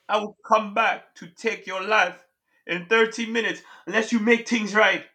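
ominous imitated Jamaican Death; Jamaican accent. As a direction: deliver the ultimatum in a Jamaican accent. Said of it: "What accent was that?".

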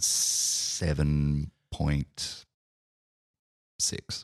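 very slow, low and soft; hesitant, hushed verdict, spaced right out; drawn-out score reveal. Real, not acted. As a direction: stretch each word with a long pause before the last.

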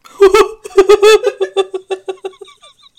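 loud high laughter; long high laugh. Real, not acted. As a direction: crack up. Laughing at the joke.

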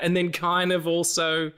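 ordinary delivery

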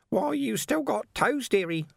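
high, slow coo with a hidden threat; aunt voice. A narrator's reading. Second aunt teasing Vimes, high and sweetly menacing. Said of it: "said another voice".

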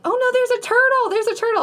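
high, wailing alarm of a frantic woman; mock hysterics.

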